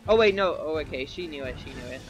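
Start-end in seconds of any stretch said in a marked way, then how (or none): 1.1-2.0 quiet aside under breath; muttering. Real, not acted. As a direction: mutter it to yourself. Muttering to self.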